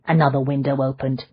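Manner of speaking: plain reading voice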